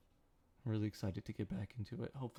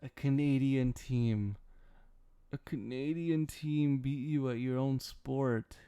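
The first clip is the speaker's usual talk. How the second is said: gloating taunt; 3 semitones higher, 5 dB louder, slower than usual. Real, not acted. Gloating at Americans over Raptors win.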